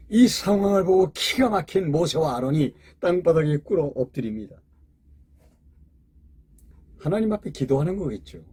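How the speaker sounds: ordinary talk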